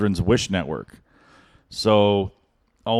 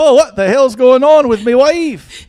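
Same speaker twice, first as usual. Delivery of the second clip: slow, high, loud Scottish punchline; mock Scottish accent; 10 dB louder than usual. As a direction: bellow it as a Scottish DJ. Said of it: "street jokes with Scottish accents".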